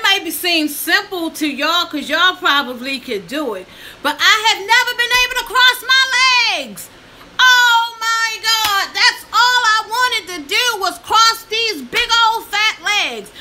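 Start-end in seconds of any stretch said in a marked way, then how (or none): 4.0-13.3 high, loud, tearful outburst; emotional cry. Real, not acted. To viewers, wailing loudly about never crossing her legs. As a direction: cry out years of frustration.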